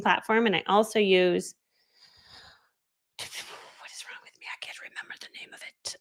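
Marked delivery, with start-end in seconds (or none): 3.2-6.0 barely voiced, searching your memory; muttered self-reproach. Real, not acted. Forgetting the service's name, whispered self-scolding.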